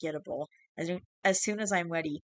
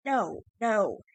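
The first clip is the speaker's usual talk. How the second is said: high, louder panicked cry; frightened yell; 5 semitones higher, 4 dB louder than usual. Acted. Changeling as the cat nears, a frightened yell. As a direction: yelp in terror at the cat. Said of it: "as the creature yelled".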